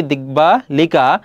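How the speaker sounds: দিক বা লিকা spelled out slowly with the ব sounded; slow pronunciation demo. Shown as a demonstration. Teacher sounding out দিক বা লিকা slowly for students. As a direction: drag out দিক বা লিকা syllable by syllable. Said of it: "এখানে রয়েছে কি?".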